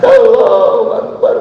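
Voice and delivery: drawn-out high Allahu Akbar; exclaimed. Acted. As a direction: cry it out slowly and high. Exclaiming in sermon. (seruan takbir panjang melengking)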